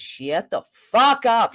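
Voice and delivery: slow, emphatic "shut the fuck up"; "shut the FUCK up", slow with weight on FUCK; very clear enunciation. Shown as a demonstration. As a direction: draw out each word of "shut the fuck up". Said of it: "that's what I can say very clearly".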